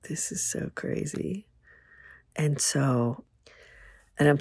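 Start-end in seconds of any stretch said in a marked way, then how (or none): 0.1-1.5 breathless whisper, almost no voice; whispered thought. Retold or imitated. Woman whispering her thought in the parking lot. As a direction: whisper it like a secret.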